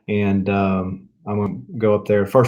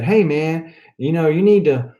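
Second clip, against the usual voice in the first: lightly imitated friend, pitched higher; higher, teasing take on a buddy talking; Rob; 5 semitones higher than usual. Friend telling him he needs to do something, lightly imitated. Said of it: "I gotta channel my inner Rob".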